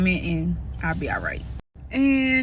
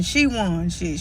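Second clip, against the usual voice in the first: ordinary delivery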